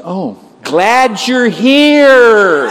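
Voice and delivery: very slow, loud, high shout; comically overloud, drawn-out greeting; shouting at a foreigner. Retold or imitated. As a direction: stretch every word out at full volume. Booms at woman who speaks no English.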